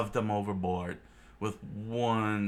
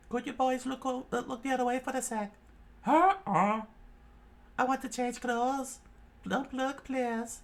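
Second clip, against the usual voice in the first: much higher, sweet request; high coy Nami. Acted. Nami asking the boys to look away, coy high voice. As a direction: ask them coyly to turn away. Nami asks them to turn.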